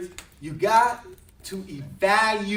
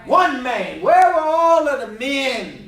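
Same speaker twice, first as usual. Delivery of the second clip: louder and higher, hammering the crowd; preaching shout; 6 semitones higher, 9 dB louder than usual. Acted. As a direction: call out the missing men. Preacher at full force.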